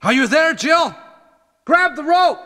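high, carrying call down a pit; calling down a hole. Acted. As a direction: shout down to her far below. Barry calls down into the hole.

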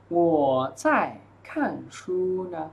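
drawn out, pausing between words; slow model reading. Shown as a demonstration. Teacher reading the dialogue answer for students, slowly. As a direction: give each word room for learners.

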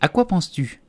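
high-pitched stern question; sharp reproach; severe scolding. A narrator's reading. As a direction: demand an answer as the cross mouse. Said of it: "s'écria la souris d'un ton sévère".

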